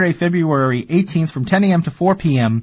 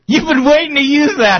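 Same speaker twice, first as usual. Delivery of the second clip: laughing, higher pitch; 12 semitones higher than usual. Real, not acted. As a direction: laugh through the words. Laughing at co-host's joke.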